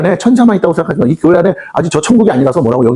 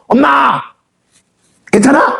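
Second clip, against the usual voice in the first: put-on child calling mom; 10 semitones higher, slower than usual.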